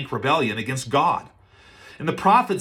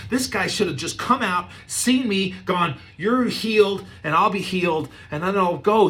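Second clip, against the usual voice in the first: higher, indignant complaint; huffy rant, voice pitched up in offence; indignant Naaman; 7 semitones higher than usual. Retold or imitated. Preacher voicing Naaman's offence, higher and indignant. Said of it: "He's like".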